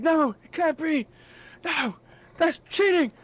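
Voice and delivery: high, loud enraged shouting; furious Minotaur. Acted. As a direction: rage at being cheated. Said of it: "huffing and puffing and getting angry".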